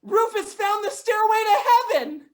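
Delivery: loud, high mock ecstasy; hammed-up rapture. Owner gushing over her dog, high and loud.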